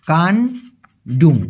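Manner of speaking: কান্ডুং stretched very slowly as kan-duŋ, falling; careful pronunciation. Shown as a demonstration. Teacher modelling the Malay word for viewers, slowly drawn out. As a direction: draw out কান্ডুং syllable by syllable. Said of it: "উচ্চারণটা হবে".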